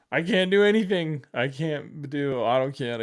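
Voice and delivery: high, loud mock wail easing down; imitated crying wail. Student at home after professor's talk, imitated tearful wail. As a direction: act out tearful despair without real sobs. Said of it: "I remember just crying".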